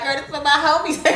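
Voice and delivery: party toast. Pretend. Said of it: "I'm gonna be like".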